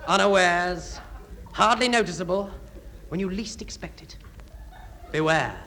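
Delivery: spooky storyteller. Acted. Spooky closing narration.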